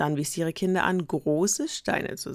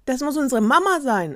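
put-on higher, louder happy kid voice; excited kid; 9 semitones higher, 7 dB louder than usual. Goat kid believing mama is back, excited. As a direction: cheer it out.

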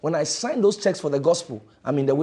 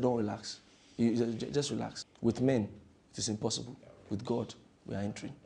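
softer, lower soothing reply; calm Jesus; 4 semitones lower, 8 dB quieter than usual. Acted. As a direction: settle the worried disciples gently. Jesus voiced calming disciples.

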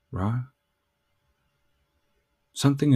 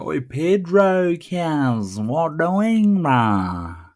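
high, loud mock holler across the yard; cousins hollering; 7 semitones higher, 6 dB louder, much slower than usual. Cousins calling out to him as Pedro, loud high holler. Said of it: "Cousins are hollering out".

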